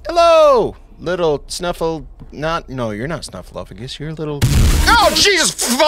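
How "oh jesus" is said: sudden high yelp of fright; jolted by a jump scare; startled cry. Real, not acted. Jump scare in horror game.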